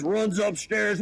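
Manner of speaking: ordinary talk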